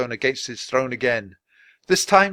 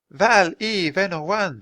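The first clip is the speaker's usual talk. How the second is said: slow, higher gentle repeat; strange language; 7 semitones higher than usual. A narrator's reading. Swan Maiden repeating her words to the wizard. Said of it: "the Swan Maiden said again".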